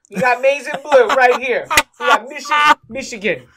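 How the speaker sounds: loud, high, thrilled fan shout; excited cheer. Real, not acted. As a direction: proudly show off your team colors. Showing off Michigan flag on camera.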